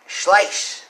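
a hammed-up German "Schleich"; German pronunciation. Shown as a demonstration. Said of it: "I can't claim my German accent pronunciation is any good".

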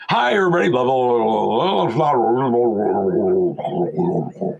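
slowed, warped mock playback; a chirpy greeting melting into mumble; garbled tape. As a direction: imitate the tape dragging again. Tape playing wrong again.

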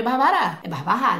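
"Barbará" stressed on the last syllable, French-style; French final stress. Shown as a demonstration. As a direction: put the weight on the final "rá" of "Barbará". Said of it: "a última sílaba é tônica". ("Barbará" à francesa, tônica no "rá" final)

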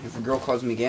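ordinary talk, nothing marked

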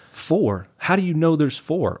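sit back and question it in disbelief; surprised disbelief. Retold or imitated.

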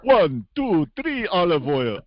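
high Transylvanian counting voice. Acted. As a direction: count each one off like a gleeful vampire. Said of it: "or the, uh, the Count on".

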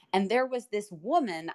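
ordinary talk

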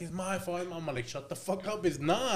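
mock-exasperated quarrel with a friend; insistent retold argument. Acted argument with guilty friend.

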